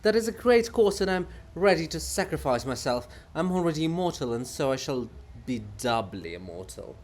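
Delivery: a hint of the pompous writer; lightly voiced weary poet. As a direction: declaim your own sacrifice. Bulgakov's grand speech.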